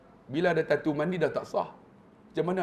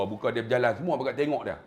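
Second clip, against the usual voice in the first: ordinary talk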